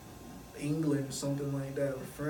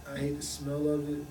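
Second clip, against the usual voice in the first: ordinary delivery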